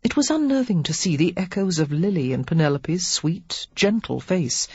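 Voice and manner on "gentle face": ordinary delivery, nothing marked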